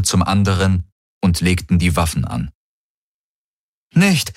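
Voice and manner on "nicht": high cry of protest; calling out. Acted. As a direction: cry out to stop them. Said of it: "wollte Galen rufen".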